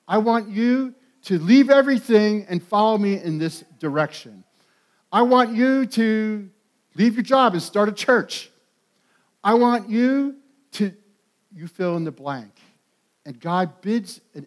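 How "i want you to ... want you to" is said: voice of God calling. Retold or imitated. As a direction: call them out as God would. Preacher voices God's call.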